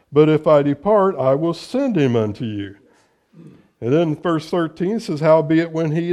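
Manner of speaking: loud, ringing preacher's reading of scripture. Acted. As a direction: proclaim the verses to the congregation. Reads scripture aloud in sermon.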